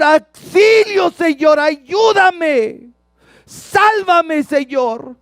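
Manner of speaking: drawn-out, high shout for help; loud, slow, pleading wail of a shout; desperate cry. Acted. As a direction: scream to God to save you. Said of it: "Porque hay esa desesperación".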